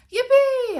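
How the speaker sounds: high, loud cheer of joy; excited yell. Acted. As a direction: shout it like the best news ever. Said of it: "I yell".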